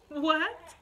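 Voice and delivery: high, loud questioning what; exasperated, rising what; incredulous. Acted. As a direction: throw it back in mock disbelief. Mock disbelief at the advice.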